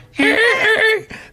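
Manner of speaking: high, loud honking squeal; bike horn imitation. Imitating the horn used to wake her up, high squealed honk. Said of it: "got a little bike horn".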